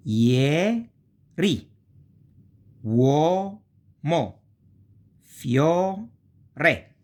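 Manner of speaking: "Ieri", "Uomo", "Fiore" pulled apart into syllables; teacher demonstrates the syllable division; syllable-divided Italian. Shown as a demonstration. Teacher showing beginners how these words split, drawn out. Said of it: "that is the division".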